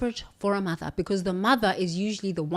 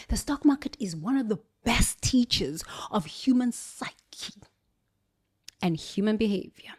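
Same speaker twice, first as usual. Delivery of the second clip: whisper; 5 dB quieter than usual. Real, not acted. Confiding insight to viewers.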